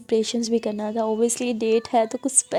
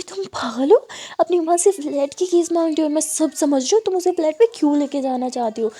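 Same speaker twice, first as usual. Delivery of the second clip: louder, higher suspicious scolding; mother scolding daughter for asking for the flat keys; 4 semitones higher, 4 dB louder than usual. Acted. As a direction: call her out, she sees through you.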